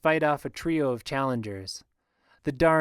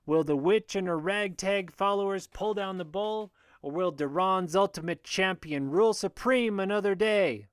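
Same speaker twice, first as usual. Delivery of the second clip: raised, ringing fight-announcer voice; booming announcer; 6 semitones higher than usual. A narrator's reading. Booker hyping the witch against the bull, booming. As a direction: pitch it high to hype the crowd. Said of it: "Booker's voice booms out".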